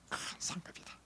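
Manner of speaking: breathy whisper of promise; hushed sermon whisper. Real, not acted. Preacher drops voice at climax.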